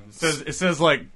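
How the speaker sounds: ordinary delivery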